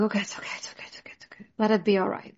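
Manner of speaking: hushed whisper, calming herself down; self-soothing whisper. Retold or imitated. Whispering to herself to calm the panic, hushed. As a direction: breathe the words out like talking yourself off a ledge.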